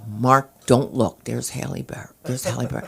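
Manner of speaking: low, soft undertone; hushed tip-off. Shown as a demonstration. Wife discreetly pointing out Halle Berry to Mark, hushed tip-off. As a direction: murmur it discreetly, low and secretive.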